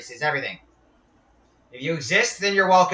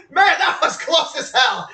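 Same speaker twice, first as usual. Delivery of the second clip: laughing; 10 semitones higher than usual. Real, not acted. Laughing after close call.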